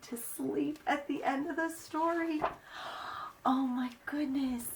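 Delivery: soft amazed whisper. Real, not acted. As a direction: breathe it in wonder. Wonder after story.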